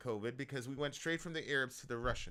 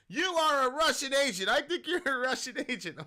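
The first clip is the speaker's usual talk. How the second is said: loud, high, hammed-up accusing anchor; over-the-top imitation of an accusing TV host; mock newscaster accusation; 10 semitones higher, 8 dB louder than usual. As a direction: mimic a pompous newscaster pointing the finger, laughing at the end. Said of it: "this newscaster guy goes".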